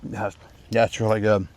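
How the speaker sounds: chewing while talking; mouth full. Real, not acted. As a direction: talk through a mouthful of food. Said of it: "You can probably hear me chewing".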